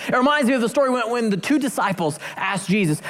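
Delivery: normal talking voice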